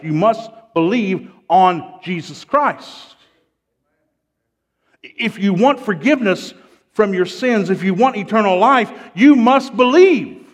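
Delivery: loud, high, urgent pulpit appeal; full-force preaching. Real, not acted. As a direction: build to a shouted climax.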